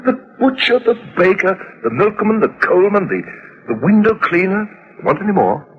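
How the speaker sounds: high-pitched, impatient roll call; pointed, high-pitched list with a challenge at the end; exasperated listing. Acted. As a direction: rattle off the obvious suspects in exasperation. Husband reels off list, exasperated.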